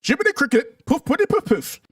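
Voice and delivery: hammed-up, high-pitched mock British indignation; bad British accent. Streamer mocking Brits boasting of a grandfather's war, bad British accent. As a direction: play an affronted Brit with a poor accent. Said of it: "I don't have a good British accent".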